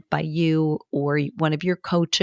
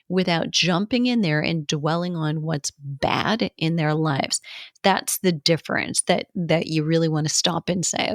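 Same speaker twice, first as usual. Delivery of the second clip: ordinary delivery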